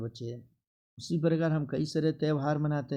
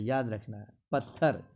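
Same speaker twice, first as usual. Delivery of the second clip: ordinary talk, nothing marked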